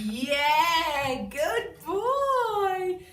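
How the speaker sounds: high, loud, sing-song praise; bright high dog praise; excited praise. Real, not acted. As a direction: gush over the dog.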